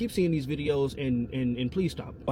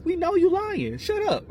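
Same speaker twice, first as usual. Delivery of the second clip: very high-pitched, incredulous outburst; exasperated escalation; 13 semitones higher, 3 dB louder than usual. Real, not acted. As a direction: shoot way up in pitch to dismiss the liar. Repeats the accusation, escalating.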